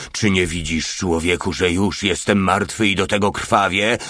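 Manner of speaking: ordinary delivery, nothing marked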